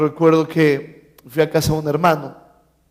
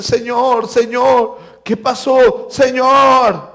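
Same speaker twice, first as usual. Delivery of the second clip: high, hammed-up cries to the master; urgent calling; 7 semitones higher, 4 dB louder, much slower than usual. Servants running to meet their master, acted urgent calls. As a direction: call out excitedly, last word drawn out.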